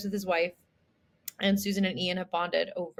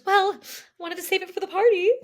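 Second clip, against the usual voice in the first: coy reveal; 11 semitones higher, 5 dB louder, faster than usual. Retold or imitated. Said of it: "And she goes".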